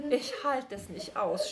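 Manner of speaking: usual speaking voice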